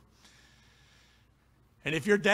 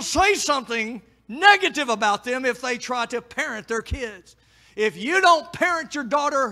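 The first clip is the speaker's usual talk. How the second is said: loud, high preacher's cry; fiery preaching; 9 semitones higher, 9 dB louder than usual. Acted. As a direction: preach it hard at the men. Preacher at full force.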